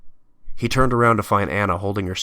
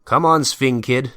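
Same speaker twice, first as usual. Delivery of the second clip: raised, loud captor's voice; commanding captor; 7 semitones higher, 7 dB louder than usual. Acted. Hitler Youth takes Peter away, commanding voice. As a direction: order the prisoner along loudly.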